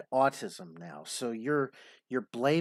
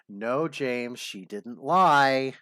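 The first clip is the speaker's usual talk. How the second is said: ordinary talk, nothing marked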